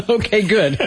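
laughing through words. Real, not acted. Laughs at wrong answer.

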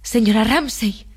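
restrained cry to someone absent; calling out aloud. A narrator's reading. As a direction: call her name slowly, with restraint. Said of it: "gritó en voz alta".

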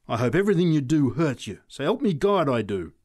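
savagely spat oath, loud and forceful. A narrator's reading. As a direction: curse them with real venom. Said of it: "she said savagely".

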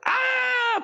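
a sudden high shriek; mock angry scream. Preacher voicing the enraged crowd with a shriek. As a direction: imitate the crowd's wordless outburst.

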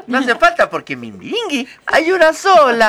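high, proud and laughing; playful princess character. Acted. Princess host boasting to co-hosts, lightly laughing. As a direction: brag that she is one of a kind.

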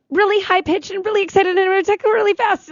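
very high-pitched, excited squirrel voice. Shown as a demonstration. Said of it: "my squirrel voice".

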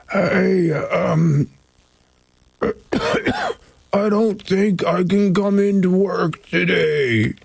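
badly faked illness, dragging the words out; fake sick voice. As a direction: pretend you're too sick for work. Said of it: "fake a good sick voice".

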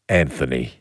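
very low, softened, formal full name; low and stately; mock formal. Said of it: "if you want to be really formal".

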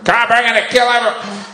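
high, far-off mock threat; robber. Robber threatening a mounted cop, high mock menace. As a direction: play a crook threatening in a high voice. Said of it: "There's some robbery".

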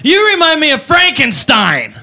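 put-on slow, slurred drunk girl; sloppy drunk insult. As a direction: drawl it like a tipsy partier. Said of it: "She goes".

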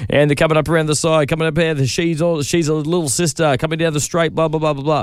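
horse racing commentator. Acted. Radio host showing how horse racing commentators sound, mock race-caller chant. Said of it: "particular affect that the horse racing commentators".

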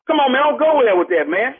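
ordinary delivery

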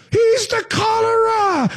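high shrill mob cry; angry mob shout. Retold or imitated. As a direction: yell it like an angry villager. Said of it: "that horrible Robert De Niro Frankenstein movie".

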